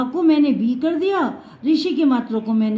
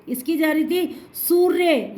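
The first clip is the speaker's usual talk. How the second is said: normal talking voice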